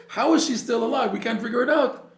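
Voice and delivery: can't believe she's still alive; baffled doctors. Retold or imitated. Doctors puzzled that the patient survived, astonished.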